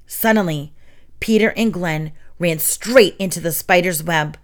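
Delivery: ordinary delivery, nothing marked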